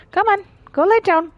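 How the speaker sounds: high, loud coaxing order; dog command. Real, not acted. Owner sends dog off to lie down, loud urging.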